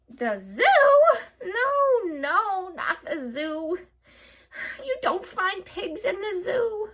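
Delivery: high, laughing, wailing hag; scoffing witch. A narrator's reading. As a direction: laugh off the silly idea. Witch rejects her own idea.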